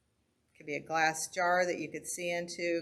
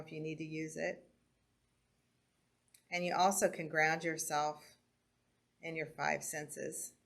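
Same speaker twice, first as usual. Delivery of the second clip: calm grounding exercise. Acted. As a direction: guide the breathing gently with pauses. Leads a breathing and senses exercise.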